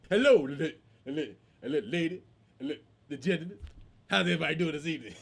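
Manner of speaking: mock stammered announcement; speech-impaired stutterer. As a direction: stumble and restart on the words. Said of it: "This dude can't speak well".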